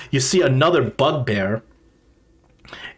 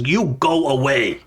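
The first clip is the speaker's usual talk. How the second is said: higher-pitched broken-speech goblin; 4 semitones higher than usual. Acted. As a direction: bargain warily as a goblin. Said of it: "this voice says".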